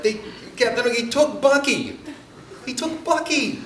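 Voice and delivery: high, loud outcry of an upset preschooler; imitated crying child. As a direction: act out a little boy wailing over a loss. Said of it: "And so he's crying".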